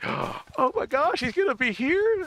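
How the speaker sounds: high, slow, star-struck squeal; gushing fan excitement. Retold or imitated. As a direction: gasp and gush like a thrilled fan.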